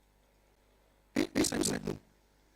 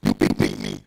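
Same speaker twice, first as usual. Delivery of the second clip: mock shout; 13 dB louder than usual. Comedian MC teasing the crowd.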